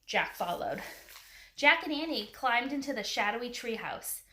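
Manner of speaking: usual speaking voice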